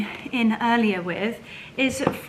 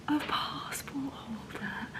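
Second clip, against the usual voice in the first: whisper; 2 semitones higher, 11 dB quieter, slower than usual. Real, not acted. Revealing item.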